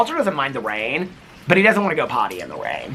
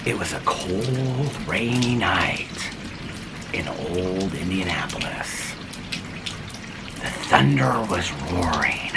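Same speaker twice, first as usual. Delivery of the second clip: slow, low noir narrator; noir detective; 6 semitones lower, 5 dB quieter, slower than usual. Acted. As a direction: tell it like a dark crime story. Spooky story opening.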